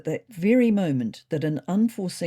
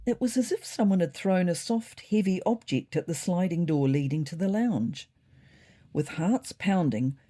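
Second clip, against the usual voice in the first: usual speaking voice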